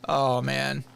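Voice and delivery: normal talking voice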